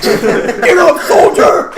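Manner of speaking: loud, high barked command; a loud, high mock order to a soldier; drill sergeant. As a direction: bark it like an officer. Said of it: "Pat yourself up".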